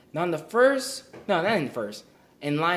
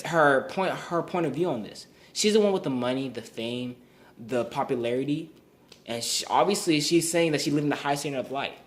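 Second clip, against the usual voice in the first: ordinary talk, nothing marked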